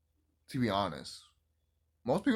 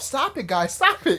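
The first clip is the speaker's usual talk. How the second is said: giddy mock plea, higher; laughing plea; 7 semitones higher than usual. Real, not acted. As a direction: beg them to stop through laughter. Laughing at a fistfight scene.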